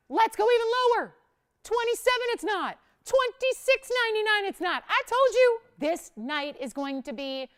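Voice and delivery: thrilled shopping-channel countdown; excited. Real, not acted. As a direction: shout the price cuts like a countdown. Host slashes price live, excited.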